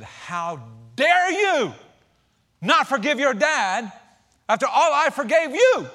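loud, slow booming rebuke from God; a slow, loud divine reprimand; stern voice of God. Retold or imitated. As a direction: thunder it down like an offended God. God rebukes Daryl in the story.

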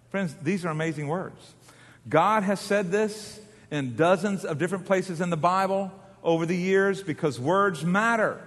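ordinary delivery

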